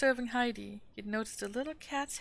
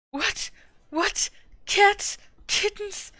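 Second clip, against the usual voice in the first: shrieking, much higher and much louder; terrified scream; 12 semitones higher, 12 dB louder, much slower than usual. A narrator's reading. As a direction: scream in horror at the cats. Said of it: "screamed Fräulein Rottenmeier".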